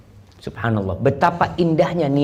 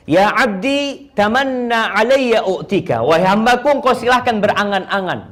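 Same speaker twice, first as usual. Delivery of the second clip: voiced divine address; 9 semitones higher, 4 dB louder than usual. Preacher voicing God speaking to Jabir's father, raised and majestic. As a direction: proclaim the offer grandly.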